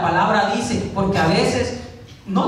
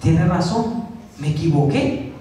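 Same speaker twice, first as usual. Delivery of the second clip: lower-pitched, humbly conceding the point; acted meek acceptance of correction; humble admission; 6 semitones lower than usual. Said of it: "Si yo soy humilde". (admitiendo el error con humildad)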